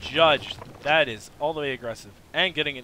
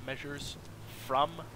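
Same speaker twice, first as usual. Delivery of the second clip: normal talking voice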